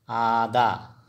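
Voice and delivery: आदा said loud and firm; emphatic repeat. Shown as a demonstration. Teacher starting the recap of words, loud emphatic word. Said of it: "फिर से repeat कर रहा हूँ".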